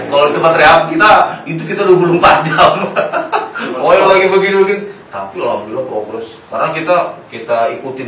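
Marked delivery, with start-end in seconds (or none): 2.8-3.7 high-pitched laugh; real laughter. Real, not acted. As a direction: laugh it off warmly. Laughs at rehab memory.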